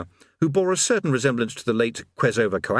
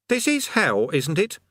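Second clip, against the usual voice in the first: high-pitched character voice; raised voice, checking a suspicion; Eric; 8 semitones higher than usual. A narrator's reading. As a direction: pipe up with a high, knowing question. Eric, another figure, asks the demon.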